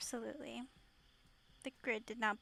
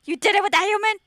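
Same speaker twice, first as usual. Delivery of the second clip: high, loud mock outrage; mock scandalised; 8 semitones higher, 17 dB louder than usual. Listener interrupting his tale of the human realm, mock scandalised. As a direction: exclaim it as if scandalised.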